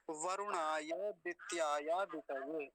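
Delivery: the phrase slowly and deliberately; slow recitation. Shown as a demonstration. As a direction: model the line slowly. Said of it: "ऐसा उच्चारण होगा". (धीरे पाठ)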